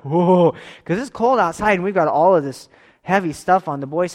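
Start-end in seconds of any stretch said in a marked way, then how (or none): 0.0-0.5 loud drawn-out whoa at the heat; walking into an overheated cabin, loud whoa; surprised whoa. Retold or imitated. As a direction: react to a hot room. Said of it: "we get in there like".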